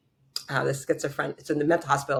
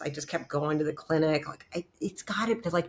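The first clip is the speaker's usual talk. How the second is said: ordinary talk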